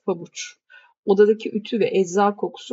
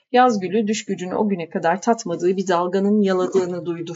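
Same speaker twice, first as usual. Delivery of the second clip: ordinary delivery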